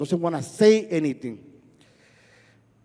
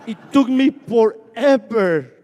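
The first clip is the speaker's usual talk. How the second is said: laughing; 4 semitones higher, 4 dB louder, slower than usual. Real, not acted. Laughing at own long struggle.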